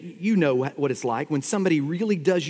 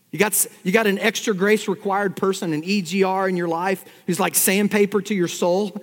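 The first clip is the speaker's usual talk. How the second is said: normal talking voice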